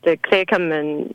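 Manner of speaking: ordinary talk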